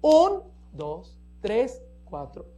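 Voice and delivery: strong downbeat count; accent on one. Shown as a demonstration. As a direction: punch the first beat, let the rest fall away. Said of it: "el uno es acentuado y el tercero es semifuerte".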